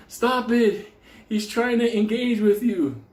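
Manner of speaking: high, insistent priest's warning; acted urgent protest. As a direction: urgently call someone off, re-enacted. Priest pulling Benny off again.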